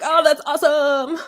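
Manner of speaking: put-on American accent. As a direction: gush like an impressed American. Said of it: "like when we're like".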